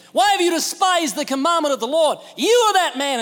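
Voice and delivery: high, loud prophetic rebuke; stern rebuke. Acted. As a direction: confront the king head on. Nathan rebuking the king.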